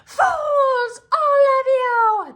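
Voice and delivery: very high, loud furious witch screech. Acted. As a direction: screech at them in rage. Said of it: "the witch screeched".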